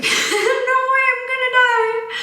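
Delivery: high laughing mock panic; laughing squeal. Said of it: "I was like".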